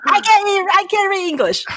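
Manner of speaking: high, whiny fake sob; hammed-up wail of helplessness; mock crying. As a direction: pretend to cry about reading rules. Mock helplessness over rule books.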